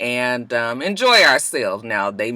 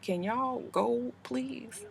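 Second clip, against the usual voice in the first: whispered plea; 7 semitones higher, 16 dB quieter, much slower than usual. Real, not acted. Whispering at people by car.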